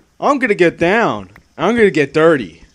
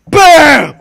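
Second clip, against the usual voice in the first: shouted bam like a blast; loud mock explosion; 9 semitones higher, 11 dB louder than usual. Gamer mimicking his trap going off, shouted bam. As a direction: belt out a cartoon explosion.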